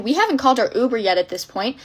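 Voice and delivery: plain reading voice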